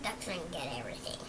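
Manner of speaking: fast, soft whisper; whispered aside. Acted. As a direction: hiss it quickly under your breath. Pigeon's muttered aside.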